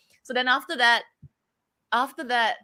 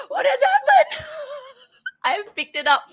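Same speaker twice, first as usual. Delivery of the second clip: very high, laughing mock horror; laughing mock shock; 5 dB louder than usual. Real, not acted. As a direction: gasp it out, laughing at yourself. Shocked at her own accent.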